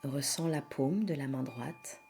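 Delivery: soft, hushed yoga nidra voice with long pauses; guided meditation. Acted. Leads a yoga nidra relaxation.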